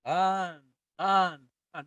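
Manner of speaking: each TAN held full length; tenuto. Shown as a demonstration. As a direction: sustain every "tan" evenly, unhurried. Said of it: "holds the note for its full length".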